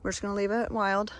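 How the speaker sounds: usual speaking voice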